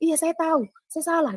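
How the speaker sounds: high, hasty concession; retold placating admission. Man admits his fault to the woman, placating. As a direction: re-enact giving in with a high-pitched apology.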